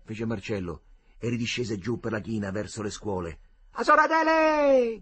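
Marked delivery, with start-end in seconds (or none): 3.8-5.0 slow long call, far higher and louder; hallway call, far higher, slow and drawn out. A narrator's reading. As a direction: call her down the corridor. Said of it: "gridò di nuovo per il corridoio".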